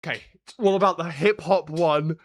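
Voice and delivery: normal talking voice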